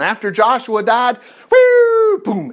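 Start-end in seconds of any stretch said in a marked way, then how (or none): 1.5-2.5 high loud whoop then a drop; whoo exclaimed. Real, not acted. Preacher crying out as Israel falls apart, a high loud whoop. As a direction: cry out the collapse.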